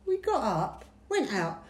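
ordinary talk

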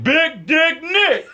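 high, slow stretched-out nickname; drawn-out nickname. Retold or imitated. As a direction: draw it out big for effect. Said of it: "You gotta say it like that".

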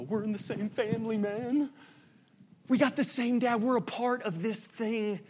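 a delighted voice greeting a fellow believer at work; excited recognition. Retold or imitated. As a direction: perk up like you found family. Ears perk up at believer.

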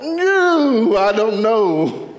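high swooping "No", then slow "I don't know"; drawn-out high wail. Shown as a demonstration. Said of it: "And I'll say it one more".

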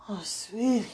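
tender whisper to a loved one; whispered endearment. Real, not acted. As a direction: breathe it out as a whisper. Whispering to absent partner.

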